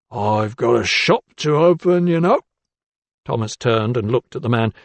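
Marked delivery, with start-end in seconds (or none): none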